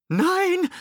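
very high, loud cry; piercingly high shout; shouted refusal. A narrator's reading. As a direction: yell to stop the order. Alice countermands the skipper's order.

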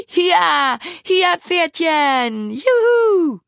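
high, drawn-out gleeful squeal rising into a whoop; delighted squeal. A narrator's reading. Carolina cheers as the tandem speeds up, delighted squeal. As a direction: squeal like a child urging on a pony. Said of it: "Carolina quietschte begeistert".

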